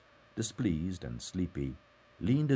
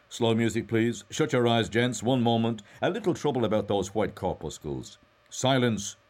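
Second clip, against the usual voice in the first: loud, raised showman's patter; mock showman; 6 semitones higher, 6 dB louder than usual. A narrator's reading. Mulligan hushes imagined gents during his mock Mass, showman's voice. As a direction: address the audience with mock ceremony.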